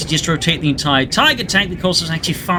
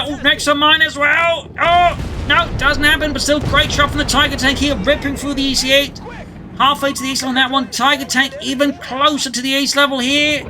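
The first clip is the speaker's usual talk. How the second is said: excited commentary; 7 semitones higher than usual. Real, not acted. Caster erupts at big hits.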